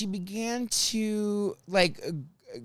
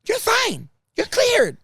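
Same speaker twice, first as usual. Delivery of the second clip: much higher, louder breezy verdict; mock sudden clerk; 8 dB louder, slower than usual. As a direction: chirp it out like nothing happened. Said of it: "she turns to me".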